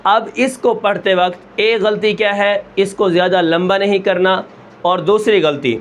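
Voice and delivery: ordinary talk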